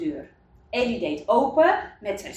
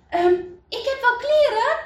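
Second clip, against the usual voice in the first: high, louder call with a rising end; eager call; 14 semitones higher, 5 dB louder than usual. A narrator's reading. Dick offering clothes to the cold family, calling out. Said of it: "riep Dick".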